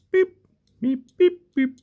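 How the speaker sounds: tiny bird-like peeps, very high; high squeaky beep. Pretend. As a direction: imitate a squeaky toy in short beeps. Imitating squeaky toy like bird.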